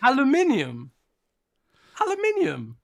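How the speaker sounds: "Aluminium" pitched high, al-yu-MIN-ium; British pronunciation. Shown as a demonstration. British co-host repeats it on request for an amazed American, high British pronunciation. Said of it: "say it again".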